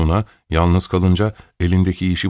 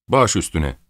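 ordinary delivery

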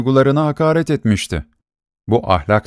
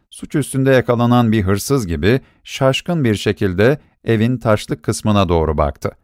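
usual speaking voice

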